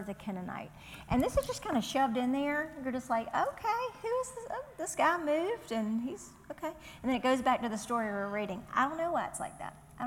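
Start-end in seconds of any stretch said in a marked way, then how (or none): 3.3-6.7 high-pitched, thrown-off questioning; puzzled reader. Acted. Puzzled reader thrown by an aside in the story, baffled and high. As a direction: react baffled, pitch jumping way up.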